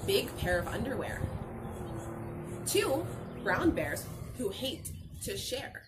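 ordinary talk

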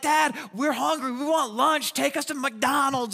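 put-on high complaining child voice; whiny hungry kid. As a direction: whine for McDonald's. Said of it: "Or if they started fighting, like".

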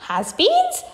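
very high, rising question; girl's high incredulous echo; astonished cry. A narrator's reading. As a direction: squeak it back in disbelief. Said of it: "cried Violet Beauregarde".

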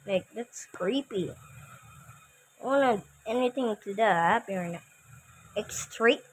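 ordinary talk, nothing marked

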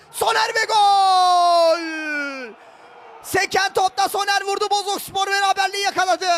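high, loud excited goal call; goal celebration shout. Real, not acted. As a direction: scream the goal for the home side.

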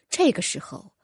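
usual speaking voice

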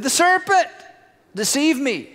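high-pitched, protesting excuse; Eve blaming. Acted. The woman blames the serpent to God, protesting.

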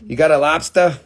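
mock Boston lobster accent. Shown as a demonstration. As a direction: lay on a broad Boston accent. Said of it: "that's probably Boston, though".

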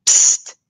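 zacht fluisterend gesis; psst. A narrator's reading. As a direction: sis om stilte. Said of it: "doet Pelle". (Pelle sist psst naar de anderen)

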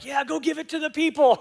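a light send-up of yourself reading the Bible; preacher's inner voice. Retold or imitated. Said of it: "you're like".

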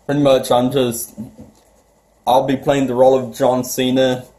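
ordinary talk

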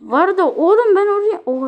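high, loud burst of gamer excitement; sudden loud shout mid-game; excited yell. Real, not acted. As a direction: yell it out at the screen.

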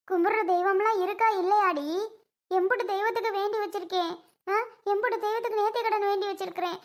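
high tearful lament; அழுகை. Acted. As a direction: wail despairingly about unanswered prayers. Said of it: "அழுகாத சுந்தரி". (அழுது புலம்பும் குரல்)

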